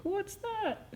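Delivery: बहुत ऊँची, धीमी, चूहे जैसी पतली आवाज़; नन्हे चूहे की तरह हैरानी से; little mouse. Retold or imitated. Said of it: "a little mouse looking up at him like".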